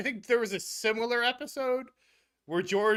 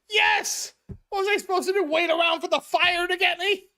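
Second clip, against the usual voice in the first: much higher, louder indignant outburst; George yelling defensively; 9 semitones higher, 5 dB louder than usual. Retold or imitated. George yelling back at Jerry that he had to flee, higher and louder. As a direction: shout it like it's obvious. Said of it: "and George goes".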